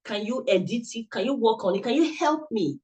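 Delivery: ordinary talk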